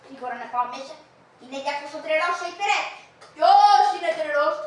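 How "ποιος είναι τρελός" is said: slow, louder, offended challenge; who are you calling mad, drawn out; indignant demand. Acted. Old master overhears and demands slowly, loud and offended.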